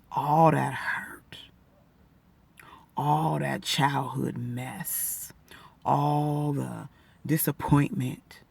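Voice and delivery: slow, hushed, close to tears; real emotion, hushed and slow; emotional whisper. Real, not acted. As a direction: whisper it slowly as the memories surface. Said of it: "I'm getting emotional now".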